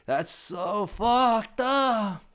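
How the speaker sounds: very slow, high, loud whine; mock wailing protest; outraged kid. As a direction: stretch every word in childish outrage. Imitating brother's outraged protest.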